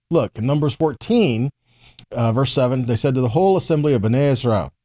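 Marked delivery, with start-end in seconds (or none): none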